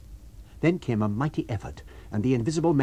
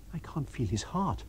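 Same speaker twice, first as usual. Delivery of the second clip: hushed dread; 3 semitones higher, 6 dB quieter than usual. A narrator's reading. Discovers the man is dead.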